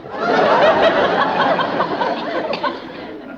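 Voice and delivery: big high mocking laugh; sarcastic laugh. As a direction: laugh off the idea of party games. Father's mocking laugh at games idea.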